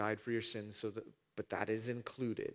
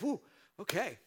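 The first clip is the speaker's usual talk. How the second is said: high, loud, easygoing relief; breezy man, lightly mocking; mock relieved man; 11 semitones higher, 4 dB louder than usual. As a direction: shrug it off like nothing happened. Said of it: "the guy's like".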